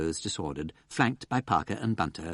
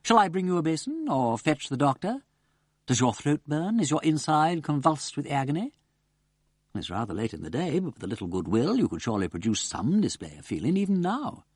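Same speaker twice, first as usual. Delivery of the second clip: sarcastic; 6 semitones higher than usual. A narrator's reading. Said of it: "said Wimsey sarcastically".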